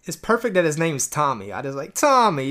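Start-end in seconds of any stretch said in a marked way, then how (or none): 1.9-2.5 put-on Staten Island accent. Imitating [name]'s Staten Island accent for the cohost. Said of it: "he's a grease ball".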